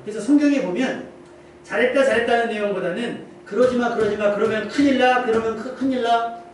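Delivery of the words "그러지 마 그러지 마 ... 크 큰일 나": insistent, slightly raised mock warning; urgent warning. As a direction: warn them off, repeating it urgently. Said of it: "경고의 메시지가 맞는다는 거예요". (다급하게 거듭 경고하는 말투)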